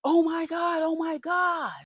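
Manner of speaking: high, alarmed oh my God; panicked cry on spotting the neighbor; shocked panic. Retold or imitated. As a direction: cry out in sudden fright. Sees neighbor across street.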